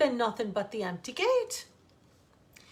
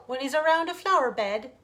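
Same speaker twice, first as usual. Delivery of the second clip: ordinary delivery, nothing marked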